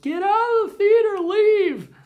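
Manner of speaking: very high, loud mock shout; angry crowd shout. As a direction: scream it like a fed-up crowd. Moviegoers throwing them out.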